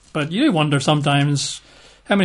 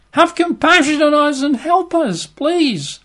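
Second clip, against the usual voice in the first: much higher, strained begging; desperate plea; 12 semitones higher, slower than usual. Acted. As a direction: cry out for help for your son. Desperate father begs Jesus.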